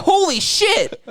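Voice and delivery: high-pitched laughing exclamation of shock; amazed outcry. Retold or imitated. Recalling the moment Chappelle clocks him, amazed high outcry. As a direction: blurt it high, stunned and delighted. Quoting own reaction to the punch.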